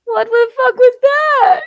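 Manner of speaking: high, rising panicked yell of a man hit by a hawk; mock attacked man. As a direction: pretend to be struck from above, squealing.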